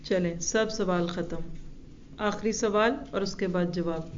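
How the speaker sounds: ordinary talk, nothing marked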